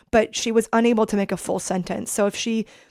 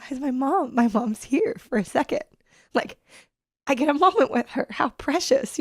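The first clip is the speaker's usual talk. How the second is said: tearful; 5 semitones higher than usual. Real, not acted. Daughter remembering precious moment with mom, tearful. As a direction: voice rising, near tears. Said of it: "I'm welling up with tears now like this".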